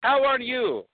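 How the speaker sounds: put-on proud Borat, Kazakh accent. Borat tells the hosts about American Idol, Kazakh accent.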